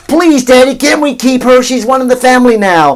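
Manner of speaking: put-on high, loud childish pleading; hammed-up kids begging to keep the calf. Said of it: "all the kids ... were going".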